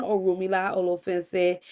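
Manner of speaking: plain reading voice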